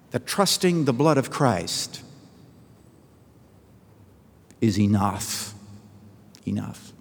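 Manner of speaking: slow, with long pauses before "enough"; measured, hushed finish; solemn conclusion. Retold or imitated. Closing line of the story.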